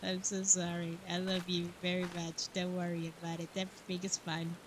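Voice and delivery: quiet sincere sorry to partner; tender apology. Real, not acted. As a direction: gently comfort someone nearby.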